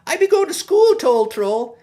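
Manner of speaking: a proud shout to the troll; called out. Acted. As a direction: call out to the troll, bright and loud. Calling out to the troll.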